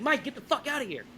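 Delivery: imitating a panicked friend, high-pitched; retold urgent plea to flee; freaking out. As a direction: act out a friend's frantic order. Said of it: "Joey's freaking out".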